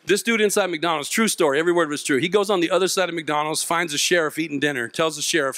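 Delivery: normal talking voice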